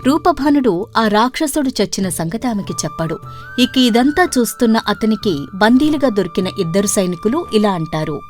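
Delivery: ordinary delivery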